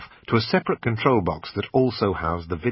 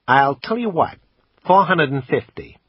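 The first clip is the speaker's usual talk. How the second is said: a higher, louder American salesman pitching the camera; pawn shop salesman; 7 semitones higher, 6 dB louder than usual. Acted. Assistant pitching the camera to the customer, salesman voice. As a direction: sell it like a pushy shop assistant.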